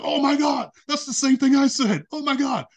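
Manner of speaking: high and loud, overjoyed; excited squeal. Retold or imitated. Girlfriend hears the same answer, excited high squeal. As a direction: gush it out in disbelief.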